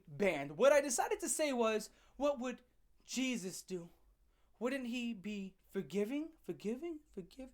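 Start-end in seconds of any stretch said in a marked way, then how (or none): 2.2-7.5 soft, mock-pious questioning; hushed sarcastic plea, trailing away. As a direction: ask it quietly with feigned innocence, fading out. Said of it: "I said that sarcastically".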